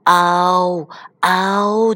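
long drawn-out "ao" calls; slow toy growl. Acted. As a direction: stretch out each animal cry slowly. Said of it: "慢慢发出". (慢慢拖长的嗷嗷叫声)

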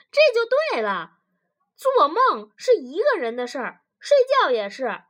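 loud, starting high and emphatic; triumphant argument. Acted. Wife scores a point on husband, triumphant. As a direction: drive the point home loudly.

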